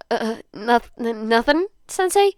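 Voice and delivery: nervous stammer, climbing high on sensei; startled stammering denial to his teacher; flustered stammer. Acted. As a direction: stumble over it, caught out. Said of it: "Denki mumbles".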